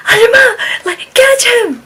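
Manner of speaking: loud, very high acted cry; urgent calling out. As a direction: imitate a frantic call for help.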